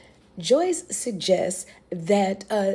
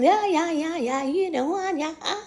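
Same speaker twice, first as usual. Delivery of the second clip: higher put-on shrugging voice, yeah yeah yeah; a careless villager, mocked higher; 8 semitones higher than usual. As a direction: brush it off like you couldn't care less. Mocking the dismissive villagers.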